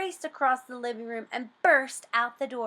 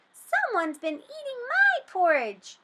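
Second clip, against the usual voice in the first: much higher, squeaky cub voice; 13 semitones higher, 4 dB louder than usual. A narrator's reading. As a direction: squeak like an upset little cub. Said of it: "squeaked Baby Bear".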